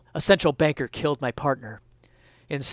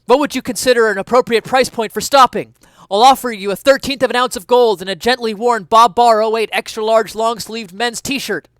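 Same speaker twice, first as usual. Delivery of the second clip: shouting; 8 semitones higher, 9 dB louder than usual. Acted. Still shouting after the suspect.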